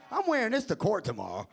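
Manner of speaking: ordinary talk, nothing marked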